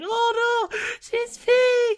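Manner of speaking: very high, loud mock panic; mock shriek. Staff shrieking together at the peeing dog. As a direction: shriek it in fake horror. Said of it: "being like".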